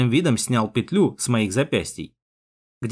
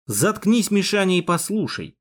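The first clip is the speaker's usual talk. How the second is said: firm, higher-pitched command; iron in the voice; 7 semitones higher than usual. A narrator's reading. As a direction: shut him down with steely authority. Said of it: "я добавил в голос железо".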